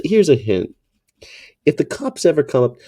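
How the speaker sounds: ordinary talk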